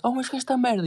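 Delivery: high indignant cry; outraged guard. Retold or imitated. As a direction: imitate the guard's indignant shout. Guard catches them. (grito indignado, muito agudo)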